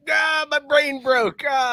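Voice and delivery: high, drawn-out wail imitating a friend; hammed-up slow cry of defeat; mock brain meltdown. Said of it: "Drew would be like".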